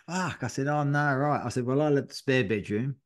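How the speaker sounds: usual speaking voice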